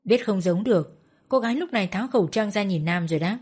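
ordinary delivery

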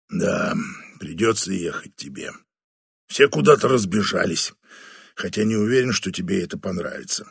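put-on low, grave older boss; weary general reporting a case. Batanda assigns Flavia reluctantly.

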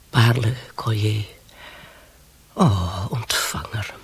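dreamy whisper. Acted. Dreaming of silk gowns.

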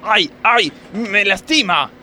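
high, loud pained yelping; pained cries. Acted. Worker cries to the officer twisting his arm. Being arrested by police.

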